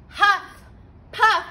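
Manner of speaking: loud, high puffs of breath; huffing wolf. A narrator's reading. Wolf blowing at the house, loud puffs. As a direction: blow hard like a wolf at a door.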